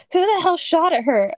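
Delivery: loud bellowing male voice. Acted. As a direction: bellow it from the doorway. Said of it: "A bellowing male voice".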